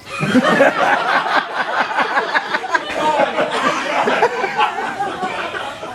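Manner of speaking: high, loud laughing fit; loud laughter. Real, not acted. Speaker reading the poem, laughing loudly at his own joke. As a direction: howl at the punchline.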